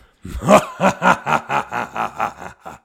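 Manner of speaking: booming villain's laugh, ringing out; a long, loud, triumphant cackle; loud evil laugh. A narrator's reading. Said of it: "Und er lachte so laut".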